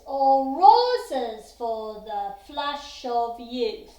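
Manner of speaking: "or" light as an upbeat, "roses" hit loud on the downbeat; slow, loud chant landing hard on "roses"; stress on roses. Shown as a demonstration. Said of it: "strong beat on the roses".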